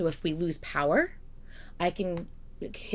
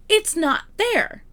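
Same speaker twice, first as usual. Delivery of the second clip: slow exasperation; 11 semitones higher, 5 dB louder, slower than usual. Real, not acted. Exasperated about diagrams.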